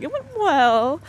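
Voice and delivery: slow, lukewarm "It went well." with a rise on "went"; underwhelmed reading. Shown as a demonstration. Boss giving lukewarm feedback, drawn out and unimpressed. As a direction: let "It went well." sound like merely all right. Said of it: "saying, like, it went all right, not great".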